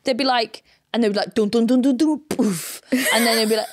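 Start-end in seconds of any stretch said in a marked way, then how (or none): 1.3-2.8 mock thudding punches vocalised; hammed-up fight noises; punching sounds. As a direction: beat out the thumps like fists landing. Mimicking punching sounds next door.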